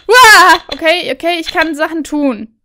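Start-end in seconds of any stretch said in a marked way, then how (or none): 0.1-0.6 sudden loud yelp; startled yell. Real, not acted. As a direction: jump and yell.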